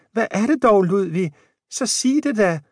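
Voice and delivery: put-on a high, eager old woman's voice; eager old wife Jane. A narrator's reading. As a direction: press him to tell, all eager.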